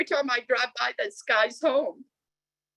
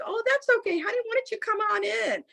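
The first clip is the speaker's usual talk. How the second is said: put-on high, sing-out elderly parent voice; put-on high voice of an old couple; 12 semitones higher, faster than usual. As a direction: imitate a sweet old parent, pitched way up. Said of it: "an elderly couple opens".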